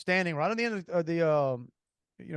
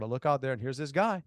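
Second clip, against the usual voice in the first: ordinary talk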